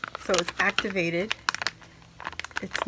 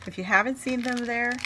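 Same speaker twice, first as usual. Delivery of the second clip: normal talking voice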